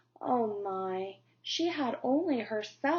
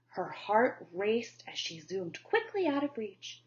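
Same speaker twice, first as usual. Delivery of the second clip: usual speaking voice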